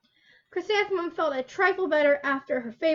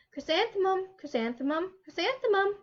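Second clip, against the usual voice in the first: lilting, dreamy call of the flowers; 6 semitones higher than usual. A narrator's reading. Flowers whispering her name to the girl, lilting and higher. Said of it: "the flowers seemed to say".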